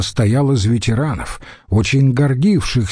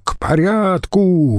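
calling out; 7 semitones higher, slower than usual. Acted. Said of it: "выкрикнул Марцел-старший".

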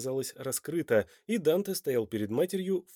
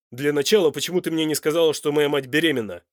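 loud, forceful questioning; demanding; 2 semitones higher, 9 dB louder than usual. A narrator's reading. Man confronts his lover over his mother's pregnancy, demanding. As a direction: demand an explanation. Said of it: "требовательно спросил Данте".

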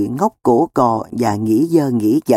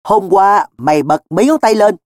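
raised, higher-pitched interrogation; cross and pressing; pointed demand; 6 semitones higher, 4 dB louder than usual. Acted. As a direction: cut in with an accusing question. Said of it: "mà hỏi đốt ngay".

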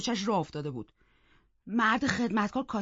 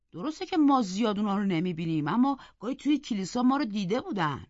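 usual speaking voice

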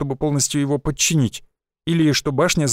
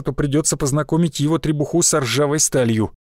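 ordinary delivery, nothing marked

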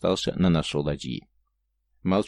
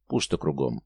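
low, resigned sigh of a reply; 6 semitones lower than usual. A narrator's reading. Lookout answers the stern that nothing is there, sighing. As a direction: say it with a weary drop in pitch. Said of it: "вздохнул Лисовин".

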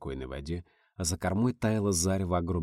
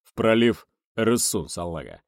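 rumbling boatswain; 4 dB louder than usual. Acted. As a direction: rumble it with authority. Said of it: "солидно ракотнул Вайткус". (солидный басок боцмана)

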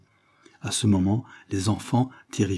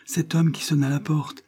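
moaning; 7 semitones higher than usual. A narrator's reading. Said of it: "fit en gémissant la servante".

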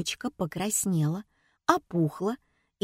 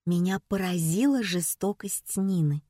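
plain reading voice

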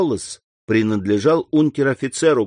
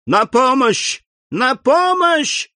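much higher, rising into a slow scream; cry for help; 12 semitones higher, 3 dB louder, slower than usual. A narrator's reading. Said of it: "закричала мадемуазель Дармельи". (отчаянный крик о помощи)

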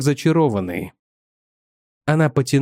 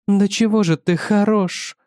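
admiring; 6 semitones higher than usual. A narrator's reading. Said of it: "восхищалась жена".

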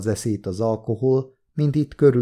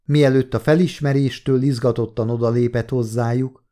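usual speaking voice

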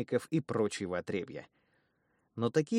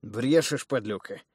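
scoffing, a little louder; distrustful; 3 semitones higher, 5 dB louder, slower than usual. Acted. Wounded thug scoffs at his brother, distrustful and a bit louder. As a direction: call him a liar, half-jokingly. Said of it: "недоверчиво прищурил глаз приятель".

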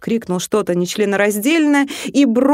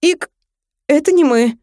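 лепет в оправдание; 8 semitones higher, 4 dB louder than usual. Acted. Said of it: "залепетал он в своё оправдание".

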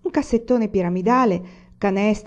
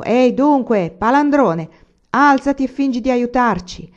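loud, higher-pitched telling-off; scolding shout; 6 semitones higher, 7 dB louder than usual. A narrator's reading. Old man to the drunkard on the ground, shouting. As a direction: shout at a layabout to get up. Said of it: "Zio Pera gridò, rivolto all'ubriaco".

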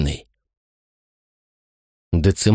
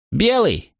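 high ringing announcement; proclaimed result; 12 semitones higher than usual. A narrator's reading. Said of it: "провозгласил песец".

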